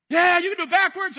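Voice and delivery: high, loud crowd answer; the crowd egging the walker on, high and loud; eager crowd. Acted. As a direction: shout back like a cheering crowd.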